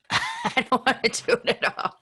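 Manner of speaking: laughing through the words. Real, not acted. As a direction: giggle it out, playfully reluctant. Laughing repeat of reluctance.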